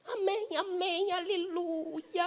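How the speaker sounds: high, soft, slow amém with a crying laugh; drawn-out tearful prayer voice; praying sisters. Retold or imitated. Preacher mimics the praying sisters, high, soft and slow.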